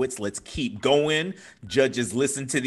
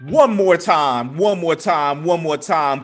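raised, rallying emcee chant; applause call; 4 semitones higher, 5 dB louder than usual. Real, not acted. Host asking for applause for the poet, loud rallying call. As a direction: whip up the crowd with each repeat.

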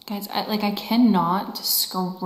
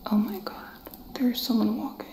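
whispered, on edge, listening hard; tense whisper; 3 semitones higher than usual. Real, not acted. Ghost hunter hearing footsteps, whispering to viewers. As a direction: whisper as if someone is right behind you.

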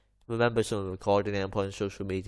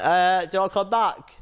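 high, loud, slow put-on voice; hammed-up taunting impression; mock voice; 8 semitones higher, 7 dB louder, slower than usual. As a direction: ham up a mocking taunt. Mocking the angry woman driving off.

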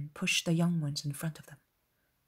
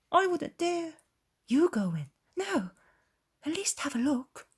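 nervous bickering; 10 semitones higher than usual. Acted. Shepherds bicker over who looks.

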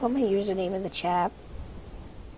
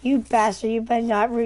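loud indignant yell at a friend; louder, outraged callout; angry shout; 3 semitones higher, 6 dB louder than usual. Real, not acted. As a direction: yell at the guy who wrecked it. Teammate ruins loot chest.

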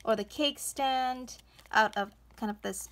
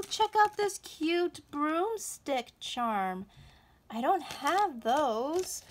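usual speaking voice

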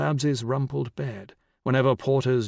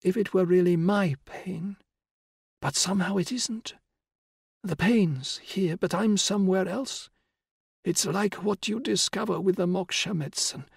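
put-on old woman whispering; 6 semitones higher than usual. A narrator's reading. Dying old woman voiced.

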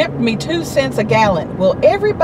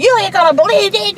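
high, fast, worked-up blurt; fast and high, bursting to tell the news; excited outburst; 9 semitones higher, faster than usual. Retold or imitated. Livid call to Eric.

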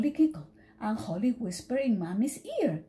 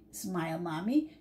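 ordinary delivery, nothing marked

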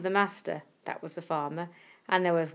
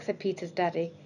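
usual speaking voice

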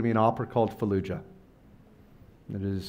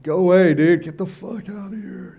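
high, loud yell to go away; angry shout; 10 semitones higher, 8 dB louder than usual. Retold or imitated. Upset veteran under his bed yelling at the visitor, angry shout. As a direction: bark him out of the room, imitating the vet. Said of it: "he's like".

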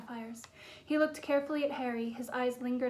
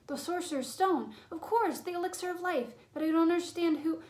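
higher-pitched burst of discovery; excited realisation; 5 semitones higher than usual. A narrator's reading. Boy realising what is hidden in the school, excited. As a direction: blurt it out as it dawns on you. Harry suddenly realises the answer.

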